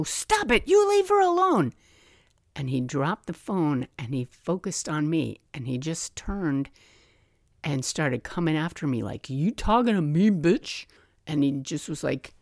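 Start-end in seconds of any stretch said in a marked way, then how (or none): 0.0-1.7 high re-enacted scream, louder toward the end; screamed command. Bystander ordering an attacker off his girlfriend, screaming. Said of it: "I just screamed at him". 9.3-10.9 higher, confrontational mock snarl; retold menacing challenge. Drugged attacker turning on the woman who intervened, menacing.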